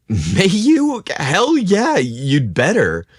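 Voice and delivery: high, loud, sudden blurt; awkward blurt. A narrator's reading. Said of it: "awkwardly blurted out".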